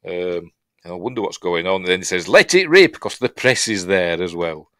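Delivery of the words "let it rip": high, loud showman's call; showman's cry. Retold or imitated. Showman releasing the balloon for the press, loud cry. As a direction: announce it for the press.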